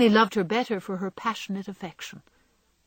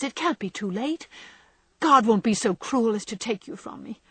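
louder, higher, defiant outcry; rebellious cry; 5 semitones higher, 5 dB louder than usual. A narrator's reading. Jo refusing to let her dying sister go, crying out in loud defiance. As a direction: fight fate with every word, voice raised. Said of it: "cried poor Jo rebelliously".

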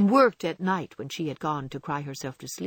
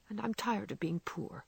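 much softer, almost under the breath; spoken very low; 11 dB quieter than usual. A narrator's reading. Meg confessing her tiredness to John, very softly. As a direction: let it slip out barely audible. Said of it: "The last words were spoken so low".

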